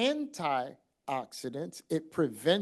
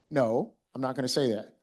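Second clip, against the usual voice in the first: normal talking voice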